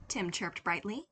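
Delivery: plain reading voice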